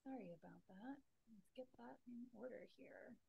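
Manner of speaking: very faint, far from the mic; barely audible. Real, not acted. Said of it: "I can barely hear you".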